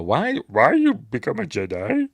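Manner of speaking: high, put-on creature voice; imitated Yoda. Yoda questioning Luke, high imitation creature voice. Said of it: "'cause he's saying".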